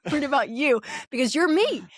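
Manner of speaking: high, laughing mock worry. Mom teasing middle daughter, laughing. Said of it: "I'm like, "Oh, no, I'm worried".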